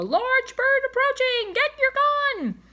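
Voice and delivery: high, loud alarmed shout; yell. Acted. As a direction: yell the warning to the storekeeper. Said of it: "he yelled to the storekeeper".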